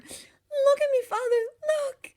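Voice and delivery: very high, loud, elated cry; Nessa's joyful cry. A narrator's reading. Daughter showing her father her first steps, joyful cry. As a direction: cry out beaming with joy. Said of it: "she cried, beaming".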